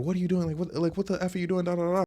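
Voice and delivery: high, rattled-off scolding; mock outraged demand. Woman chasing the speaker down, mock outraged.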